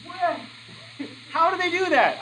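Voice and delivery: high, soft startled what; mock shock at the hole; startled texter. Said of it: "and they go".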